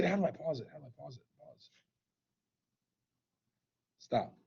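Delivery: very soft, fading self-talk; muttering to himself. Real, not acted. Teacher hunting for how to pause it, muttering to himself. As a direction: trail away into a private murmur. Asks himself while fumbling controls.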